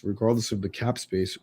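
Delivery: ordinary talk, nothing marked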